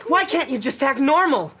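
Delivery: angry shout. Acted. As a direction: snap at her to stop. Son explodes at mother.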